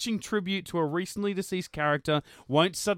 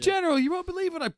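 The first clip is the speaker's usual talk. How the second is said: excited soldier report; 13 semitones higher than usual. Pretend. Acted Stargate scene parody.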